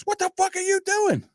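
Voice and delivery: very high, incredulous retold yell; mock outrage. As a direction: imitate an alarmed, angry cousin. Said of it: "him and I are nose to nose spitting".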